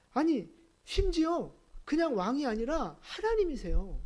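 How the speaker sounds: high, insistent protest; impassioned preaching. Real, not acted. Preacher insisting to congregation Jesus is truly God, impassioned. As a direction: plead the point with rising fervour.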